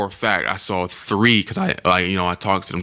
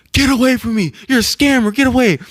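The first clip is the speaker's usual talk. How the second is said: high, loud frantic yell; retold yell; 4 dB louder than usual. Tour kid yelling at a scammer to get away. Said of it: "he yells at the top of his lungs".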